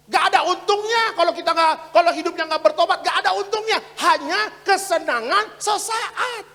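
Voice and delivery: very loud, chanting the repeated exhortation; preaching at full force. Real, not acted. Pastor urging people away from sin, preached at full force.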